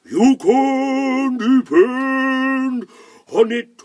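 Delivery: high, very loud, long-held howl; howling wind character voice; blowing north wind. Acted. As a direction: blow the words out slowly like a gale. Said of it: "it blew and it said".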